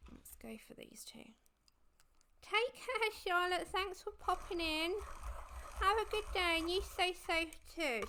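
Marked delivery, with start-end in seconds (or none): 2.4-3.7 cheery farewell. Real, not acted. Farewell to a visitor. 3.7-8.0 words through a pencil held in the mouth; pen in teeth. Real, not acted. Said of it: "I got a pencil in my mouth".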